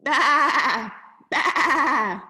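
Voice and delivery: loud wavering sheep baa; bleating sheep. A narrator's reading. Reader voicing the sheep for a child, loud bleats. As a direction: bleat it out loudly. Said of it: "bleated the sheep".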